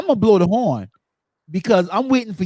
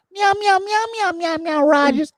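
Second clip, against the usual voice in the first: much higher, nasal-free mock meow repeated; mocking cat meows; 12 semitones higher than usual. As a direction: squeak out the TV cat's meows to mock it. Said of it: "Cats don't talk like that".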